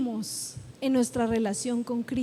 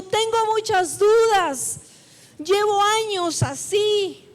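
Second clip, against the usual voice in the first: emphatic confession; 3 semitones higher, 4 dB louder than usual. Acted. Preacher voices the father admitting years of doubt, emphatic confession. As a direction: own up forcefully, drawing out the end.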